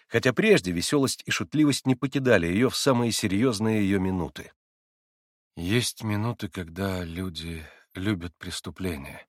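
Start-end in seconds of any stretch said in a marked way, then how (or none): 5.5-9.2 slow, low and soft musing; thinking it over aloud; quietly pensive young monk. A narrator's reading. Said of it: "задумчиво проговорил Алёша".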